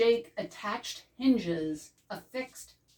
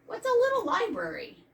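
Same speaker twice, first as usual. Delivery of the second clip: put-on very high and quick little kid's question; high curious child voice; 4 dB louder, faster than usual. Young classmate asking about the gift, high and fast. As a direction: pipe it up fast like a small child.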